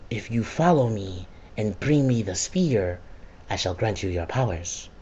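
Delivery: low, soft, solemn villain offer; ominous villain. Retold or imitated. Kessler offering powers to Alden, hushed and solemn.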